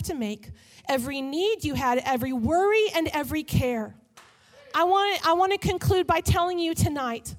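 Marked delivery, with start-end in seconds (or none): 4.7-7.3 slow, loud, high preaching; preaching at full force. Real, not acted. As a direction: build the message to a passionate peak. Preaching climax.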